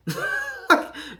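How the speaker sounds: short breathy chuckle; laugh. Real, not acted. Parent joking about overreacting to own mistakes, laughing. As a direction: laugh at your own exaggeration.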